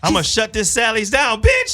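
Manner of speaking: high, worked-up retold threat; heated threat. Customer threatening a shop with bad reviews, heated threat. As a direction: throw it out high, as a showy warning. Retelling her threat in store quarrel.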